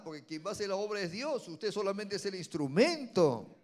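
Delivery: loud, rising pulpit sermon; preaching at full force. Acted. Preacher warning the congregation against pride, at full force. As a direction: hammer the lesson home to the congregation.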